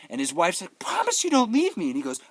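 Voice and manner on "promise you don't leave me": high, louder imitation of a wife; mock pleading wife. Wife begging the son to stay, mock pleading.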